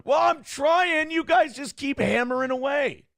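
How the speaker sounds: high, loud, drawn-out complaint; exasperated protest. Acted. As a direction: cry out in mock exasperation. Mock protest at viewer comments.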